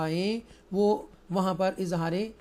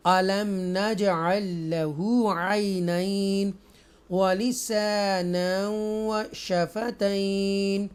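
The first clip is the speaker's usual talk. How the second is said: slow, drawn-out tajweed chant; Quran recitation; much slower than usual. Shown as a demonstration. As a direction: recite each word slowly and melodically.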